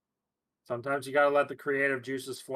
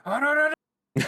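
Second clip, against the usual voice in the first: mock panic; 14 semitones higher, 6 dB louder than usual. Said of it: "and he's just going".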